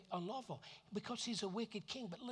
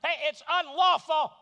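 loud, high accusing yell; 11 semitones higher, 17 dB louder than usual. Acted. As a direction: call him out across the courtyard. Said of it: "he's yelling out John the Baptist".

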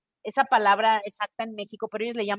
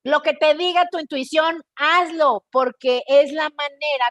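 expressive imitation; 5 semitones higher, 5 dB louder than usual. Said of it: "es como muy expresivo".

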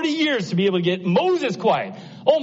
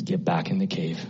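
hushed whisper; 10 semitones lower, 6 dB quieter than usual. Acted. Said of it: "He says".

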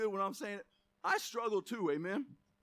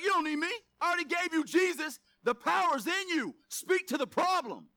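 high, insistent voice of God; insistent exhortation; 7 semitones higher, 3 dB louder than usual. Acted. Preacher voicing God to believers, insistent exhortation. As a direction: press each phrase home in a raised voice. Acts out God's impatient reply.

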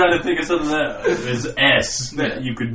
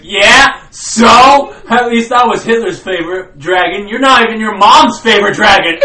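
smug comeback; 5 semitones higher, 6 dB louder than usual. Pretend. Voicing the dragon's comeback.

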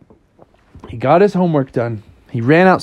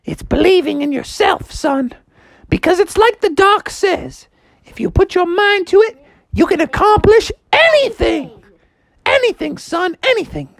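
high-pitched mom pep talk; high, emphatic mock-motherly encouragement; Froggy's inspirational mom. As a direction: preach self-belief in a high voice.

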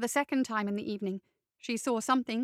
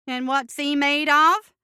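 patient; 3 semitones higher, 9 dB louder than usual. A narrator's reading. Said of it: "Ray asked patiently".

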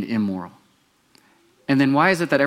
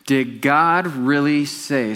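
slow emphatic reading; 3 semitones higher, 3 dB louder, much slower than usual. Shown as a demonstration. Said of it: "read that with me".

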